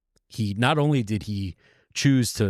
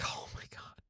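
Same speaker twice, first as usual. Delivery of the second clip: a hushed, much softer gasp of disbelief; stunned whisper; 19 dB quieter than usual. Retold or imitated. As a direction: barely voice it, crushed. Slave reacts to missed rescue.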